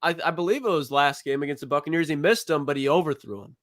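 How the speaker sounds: ordinary delivery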